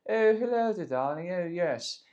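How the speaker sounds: high, hammed-up upper-class English accent; plummy, mock-aristocratic English accent; posh English accent. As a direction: play a posh English gent showing off. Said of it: "posh English accent".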